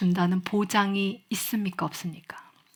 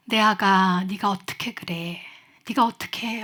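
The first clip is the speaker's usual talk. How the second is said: reading a mother's letter; 5 semitones lower than usual. Acted. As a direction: read it as a mother pleading with her teen. (조용히 애원하듯 편지 낭독)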